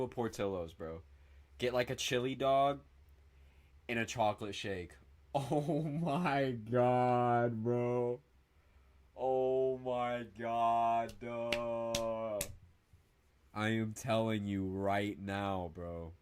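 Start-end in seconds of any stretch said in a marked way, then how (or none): 5.3-12.4 very slow, stretched-out swooning over food; blissed-out, dragged-out exclamation; drawn-out rapture. Real, not acted. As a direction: savor every syllable in long held notes.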